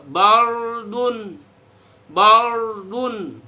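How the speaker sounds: bardun stretched slowly with a thick r; slow model bardun for students; repeat slowly. Shown as a demonstration. Teacher modelling bardun for students to repeat, slowly.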